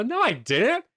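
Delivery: high, protesting mock outrage; indignant denial. Craig denying he was there yesterday, indignant denial.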